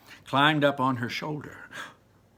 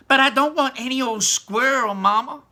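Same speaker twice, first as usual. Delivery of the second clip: high, loud whiny shout at mama; 13 semitones higher, 11 dB louder than usual. Acted. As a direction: yell it like a spoiled brat. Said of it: "Veruca shouted".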